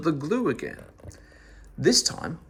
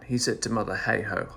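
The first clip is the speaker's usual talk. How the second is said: usual speaking voice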